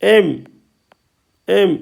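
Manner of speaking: "एम" said alone with an open am vowel; open ऐ sound. Shown as a demonstration. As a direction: model "एम" emphatically, opening the vowel. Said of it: "ए का उच्चारण आता है आई की ध्वनि में". ("एम" में खुली ऐ की ध्वनि)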